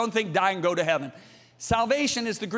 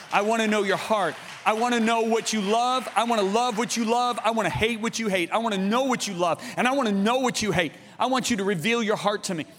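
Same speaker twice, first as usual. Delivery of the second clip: raised, insistent pleading in a driving repeated rhythm; fervent prayer; 4 semitones higher than usual. Retold or imitated. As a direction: press each petition home with rising fervour.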